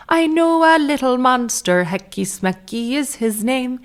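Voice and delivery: high, sing-song fake Irish cartoon voice; fake Irish accent. Mimicking a tooth-germ cartoon song, fake Irish lilt. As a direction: chant a kids' jingle in mock Irish. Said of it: "like a fake Irish accent".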